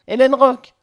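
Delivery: loud, high shout of a name; acted warning cry; calling out. Quotes her warning cry.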